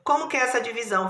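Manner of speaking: normal talking voice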